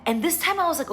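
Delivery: ordinary talk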